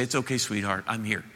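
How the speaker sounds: put-on mother comforting her frightened boy, soft and low; soothing mother. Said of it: "She'll say".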